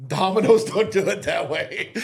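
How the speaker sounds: slow, loud, laughing protest; mock the cocky kid, laughing. Young cook arguing, laughing.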